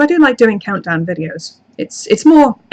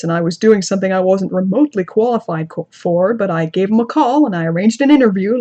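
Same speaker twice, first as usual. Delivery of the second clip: awkward put-on American narrator accent; storytelling in a badly faked American accent; stilted American accent. Shown as a demonstration. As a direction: attempt an American accent, stiff and careful. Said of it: "And it sounds like this".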